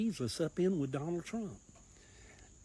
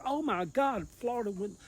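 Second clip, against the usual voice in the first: higher, louder imitation of stunned pundits; mock amazed exclaim; 8 semitones higher, 5 dB louder than usual. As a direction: play the shocked news watchers, a touch hammed. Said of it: "They're like".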